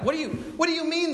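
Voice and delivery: fast, high-pitched mock disbelief; incredulous. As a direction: stammer it out, comically baffled. Acted baffled reply to Jesus.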